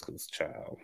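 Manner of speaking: a hushed, exasperated aside to the viewers; whispered aside. Real, not acted. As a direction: drop to a whisper, shaking your head.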